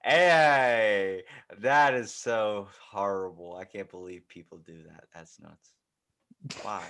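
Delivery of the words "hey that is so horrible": mock outrage.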